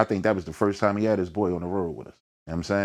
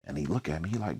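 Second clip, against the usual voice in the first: normal talking voice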